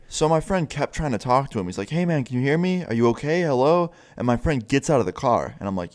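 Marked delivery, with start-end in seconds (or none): 1.9-3.9 higher-pitched imitation of hailing someone; calling out. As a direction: try to get through to someone unresponsive. Friend calls to unresponsive man.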